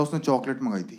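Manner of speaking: low, soft heartbroken lament; mock tearful. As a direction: play a dumped man, voice sunk low. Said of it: "रो रहा है।". (रुआँसी, दबी आवाज़ का अभिनय)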